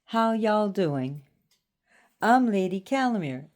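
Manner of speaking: put-on Southern hospitality twang; Southern accent. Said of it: "talking like the Southern people".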